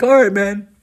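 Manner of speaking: a high, breezy imitation of the buddy; buddy's goodbye. Buddy voiced signing off.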